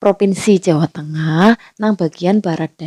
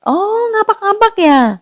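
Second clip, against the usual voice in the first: much higher, amused "oh, ngapak-ngapak ya?"; mock a stranger delighted to spot the ngapak accent; teasing recognition; 13 semitones higher, 3 dB louder than usual. Stranger teasing Banyumas dialect. (niru wong sing ngenyek ngapak, swarane dhuwur banget)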